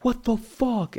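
high, mocking angry outburst; imitated angry rant. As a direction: play the guy losing it over a call. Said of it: "he starts going off".

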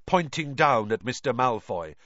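ordinary delivery

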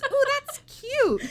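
very high, drawn-out loud coo; mock admiration. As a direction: imitate a clueless friend gushing. Mocks friend's oblivious admiration.

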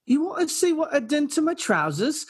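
put-on high-pitched country girl accent; high, rising question in a put-on rural accent. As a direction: imitate a girl's thick country twang. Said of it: "So you get the accent".

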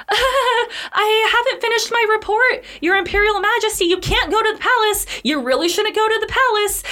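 very high, desperate babbling; frantic plea. Retold or imitated. Soon clinging to the litter, pleading with the emperor in high panic. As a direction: squeal it out in breathless panic, pitched far up. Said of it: "And he's like".

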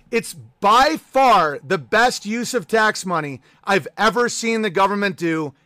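ordinary talk

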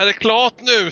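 shouted question. Acted. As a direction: yell it impatiently. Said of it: "skriker jag".

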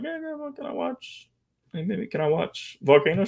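put-on high, soft little-kid voice asking grandma; mock kid pleading. Grounded kid asking grandma to watch a movie, high and sweet. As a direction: sweetly beg like a grounded child. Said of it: "I'm like".

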